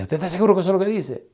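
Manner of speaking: rushed, high mock doubt; incredulous question. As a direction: ask it like you can't believe the verse. Doubting student questions the verse.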